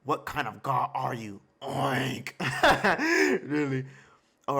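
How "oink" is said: pig oink. Retold or imitated. Reactor mimics the boar god.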